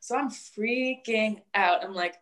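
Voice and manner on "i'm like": ordinary talk, nothing marked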